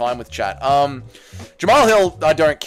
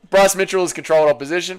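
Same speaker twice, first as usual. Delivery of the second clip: normal talking voice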